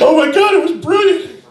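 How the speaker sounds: high, loud laughing rave; gleeful delight. Retold or imitated. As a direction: act out a thoroughly amused dad. Dad delighted by the incident.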